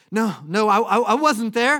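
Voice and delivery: high, stammered loud protest. Acted. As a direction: deny it loudly, tripping over the words. Peter denies a second time.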